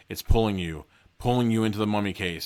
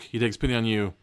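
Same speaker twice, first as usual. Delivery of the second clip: plain reading voice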